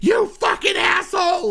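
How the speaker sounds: high, shrill mock screaming; mock furious girlfriend. Girlfriend flipping to rage at boyfriend, high mock scream. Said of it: "and so what".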